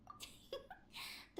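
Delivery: quick breathy giggle; a light, quiet, mischievous titter; soft giggle. Real, not acted. Funeral director giggling after her spooky story.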